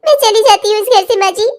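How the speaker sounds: halting, self-sacrificing tearful speech; crying. Acted. Daughter-in-law leaving six months after the wedding, crying. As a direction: sob through the farewell.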